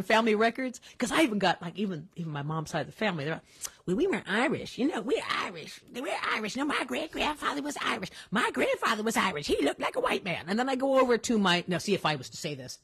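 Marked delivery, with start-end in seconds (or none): 3.9-10.4 lightly voiced insistent aunt; imitating Aunt Marlene. Niece retelling her aunt's family claims, lightly mimicked aunt voice. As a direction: put on a hint of your aunt. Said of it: "That's how my Aunt Marlene would talk".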